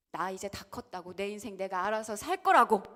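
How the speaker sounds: defiant talking back. Retold or imitated. Teen talking back to parent, loud and defiant. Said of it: "대들고 덤비고".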